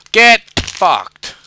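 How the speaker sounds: loud, high gloating yell; real gloating shout; taunting shout. Real, not acted. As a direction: yell it at the game in triumph. Taunting after making a jump.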